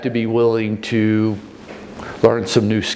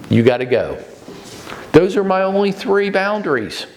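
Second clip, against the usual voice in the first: ordinary talk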